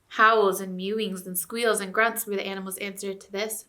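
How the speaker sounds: usual speaking voice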